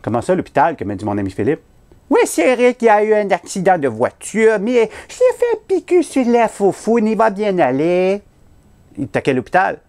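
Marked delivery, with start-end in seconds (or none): 2.1-8.2 high, hammed-up Haitian accent; bad Haitian accent. Fake nurse tells friend about the accident, bad Haitian accent. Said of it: "accent poche haïtien".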